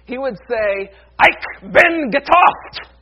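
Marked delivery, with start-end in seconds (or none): 1.2-3.0 loud, drawn-out, high-pitched furious bellow; angry German yell. Acted. Luther yelling out at night against temptation, angry German shout. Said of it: "in his angriest German voice".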